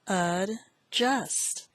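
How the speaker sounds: "Adjust" split into ad, then just; syllable by syllable. Shown as a demonstration. Teacher repeats word four, "Adjust" broken in two. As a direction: say "Adjust" in two separate beats.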